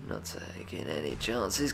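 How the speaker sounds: ordinary talk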